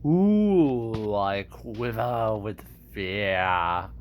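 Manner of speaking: slow, mocking villain drawl, dropping low; low, drawn-out sneer at a threat; Scar. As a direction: drag out the sarcastic fake fear. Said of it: "I can kinda do Scar".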